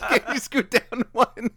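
put-on high, slow girly voice through laughter; mock her while cracking up; laughing girl voice. Repeated laughing.

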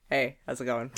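low casual greeting voice; voice one. Shown as a demonstration. Showing off a first voice, low casual greeting. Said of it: "I can do this one".

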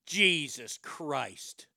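ordinary talk, nothing marked

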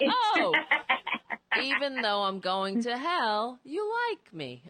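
high, loud "oh" of feigned delight; hammed-up, high-pitched gushing reply; mock delighted surprise. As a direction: play exaggerated flattered surprise. Acted mock-flattered reply to backhanded compliment.

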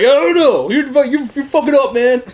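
high, loud, stumbling cop imitation; exaggerated officer, tripping over the words; mock officer voice. Officer as prisoner remembers him, hammed-up and loud. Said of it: "This is how I remember it".

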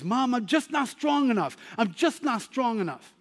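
put-on a small boy who feels he has lost; a hint of a dejected child; discouraged little boy. Boy complains to his mom.

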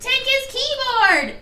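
high, slow mock command to seize the keyboard; mock shout. Retelling the movie to her cohost, a high mock shout. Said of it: "And he's like".

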